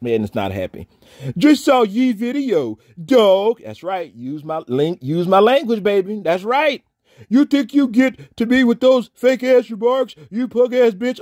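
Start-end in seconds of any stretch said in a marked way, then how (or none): 1.4-3.6 high, louder, slow sneering read; mock-reading a hater's email. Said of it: "read for this whack-ass email". 7.3-11.2 high, louder sneering mock voice; mocking email read. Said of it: "read for this whack-ass email".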